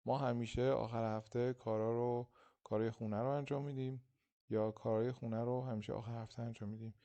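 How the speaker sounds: ordinary talk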